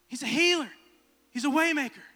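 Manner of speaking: high, loud preaching voice. Acted. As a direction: preach it to the church. Preaches to the church.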